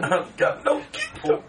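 very high, slow, breathy squeak; squeaky toy voice. Shown as a demonstration. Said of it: "When you say king, it's gotta go up".

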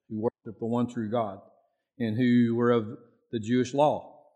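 normal talking voice

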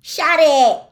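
loud, high bellowing. A narrator's reading. Fred bellowing at the wailing egg, hands over ears. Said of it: "Fred bellowed".

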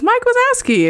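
put-on very high, sing-song child calling the name; high child's voice calling Mike Wazowski; little kid voice. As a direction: pipe it up like a tiny toddler. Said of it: "another voice that says".

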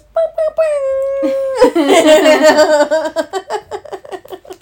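big high burst of laughter; loud laugh. Real, not acted. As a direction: crack up loudly. Big laugh after intro.

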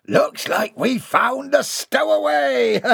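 high, slow pirate shout with a cackle; laughing pirate. Acted. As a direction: crow over the stowaway and laugh. Pirate finds a stowaway.

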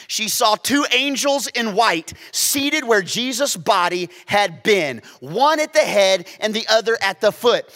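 high, loud preaching retelling. Acted. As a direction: drive the story home from the pulpit. Preaching the story at full force.

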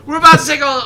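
ordinary talk

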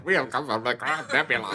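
comic alien emissary; lightly laughing alien proclamation. Acted. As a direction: announce it as a put-on alien visitor. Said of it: "There's a good one".

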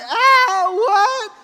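loud, high mock bawling; tearful wail. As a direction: imitate a child bursting into tears. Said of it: "now she's got tears like".

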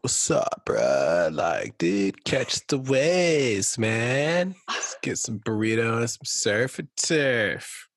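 slow, drawn-out surfer-bro voice, pitch swooping; Californian surfer dude. Acted. Co-host imagines what tourists expected, Californian surfer dude. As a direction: stretch it out like a stoked Californian surfer. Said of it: "expect you to be like".

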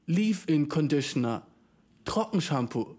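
ordinary delivery

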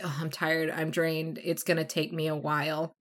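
soft, tired, sighing voice; weary, drained. Retold or imitated. Morgana telling Crick she's too drained, softly weary.

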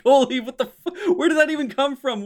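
high, loud, laughing bewilderment; high laughing outburst of disbelief. Retold or imitated. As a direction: laugh out your shock. Laughing shocked reaction.

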